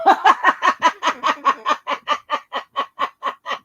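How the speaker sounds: long, helpless laughter dying away; real laughing fit. Real, not acted. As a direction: burst out laughing and keep going. Cracks up at the story.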